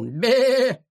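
high, drawn-out sheep bleat; bleating cry. A narrator's reading. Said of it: "тот крикнул". (крик блеющего барана)

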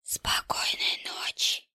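slow, voiceless whisper; menacing whisper. A narrator's reading. Killer behind the detective's back, slow creepy whisper. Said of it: "шепнул кто-то за спиной".

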